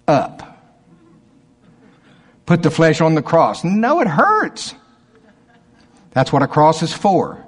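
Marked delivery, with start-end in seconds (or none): 3.6-4.7 mock complaint, pitched high and drawn out; whiny objection. Reluctant believer objecting to the cross, whining. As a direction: whine it slowly, higher.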